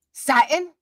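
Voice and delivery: high, loud incredulous echo; loud baffled question. Real, not acted. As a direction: pounce on the word in disbelief. Repeats a misspelled word.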